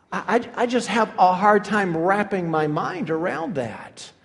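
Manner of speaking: ordinary talk, nothing marked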